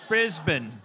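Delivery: "Brisbane" loud, with a reduced last syllable, "Briz-bn"; Australian pronunciation. Shown as a demonstration. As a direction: proudly punch an Aussie "Brisbane" as "BRIZ-bn". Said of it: "my character's gonna be from".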